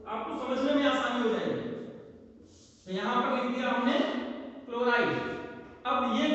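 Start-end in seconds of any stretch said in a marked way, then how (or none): none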